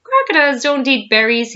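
high, loud declaration; scornful correction. A narrator's reading. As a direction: blurt it out loudly, scoffing. Crocodile scoffs at bird's guess.

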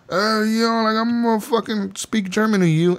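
voicing the German who won't give in; mocking German debtor. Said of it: "and he's like".

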